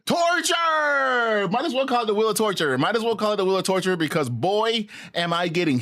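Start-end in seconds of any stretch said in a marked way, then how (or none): 0.1-1.5 slow, mock anguished wail; milk the word like a lament; dramatic drawl. Dramatic naming of the wheel. 1.5-3.9 fast. Real, not acted. Fast aside repeating name.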